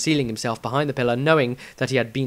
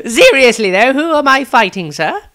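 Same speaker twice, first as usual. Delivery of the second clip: much higher, louder cocky voice; young Maestro; 11 semitones higher, 9 dB louder than usual. Acted. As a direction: still amused, pressing for an answer.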